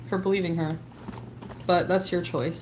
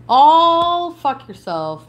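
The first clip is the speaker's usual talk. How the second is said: angry slow curse; 4 semitones higher, 6 dB louder, much slower than usual. Real, not acted. Blocking chatters in anger.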